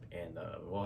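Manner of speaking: normal talking voice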